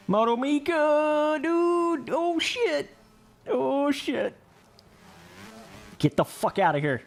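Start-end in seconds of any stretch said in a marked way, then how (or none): 0.1-4.3 high excited yelling. Real, not acted. Watching a friend crash.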